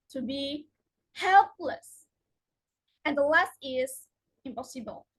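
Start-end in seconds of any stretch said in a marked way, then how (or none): 1.1-2.0 HELPless, higher first syllable, light -less; suffix left unstressed. Shown as a demonstration. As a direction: stress help in helpless. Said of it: "are not usually stressed".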